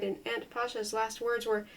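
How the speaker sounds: plain reading voice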